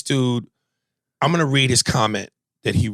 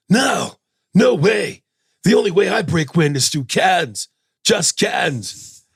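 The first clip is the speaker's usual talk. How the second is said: higher, drawn-out movie-star impression; raised voice, protesting in celebrity caricature; Keanu; 8 semitones higher, slower than usual. Passenger denies breaking wind in the car joke, celebrity impression.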